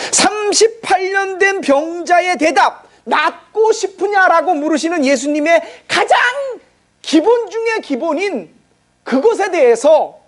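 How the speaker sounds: pleading as his voice breaks; tearful preaching. Real, not acted. Preacher's voice rises, near tears. (울먹이며 천천히 설교하듯)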